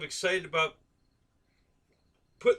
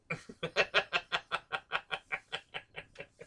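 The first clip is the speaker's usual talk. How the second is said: soft long laugh; 7 dB quieter than usual. Real, not acted. Laughing at the rectal joke.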